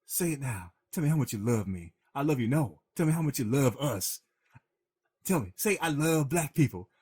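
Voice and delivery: pushing client. Retold or imitated. Cuba Gooding Jr. pressing Jerry over the phone, demanding. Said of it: "and he goes".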